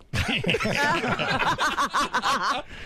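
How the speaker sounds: a long, high, helpless laugh; can't stop laughing, high and loud. Real, not acted. Guest recalling the lady smoking in the laundromat, long helpless laugh.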